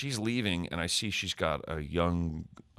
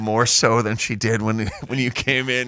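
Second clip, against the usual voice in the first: laughing; 2 semitones higher, 7 dB louder than usual. Real, not acted. Laughing at his own story.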